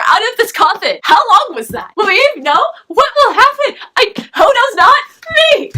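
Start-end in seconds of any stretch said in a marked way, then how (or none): 2.0-5.7 excited teasing. Real, not acted. Mock suspense, rising excitement.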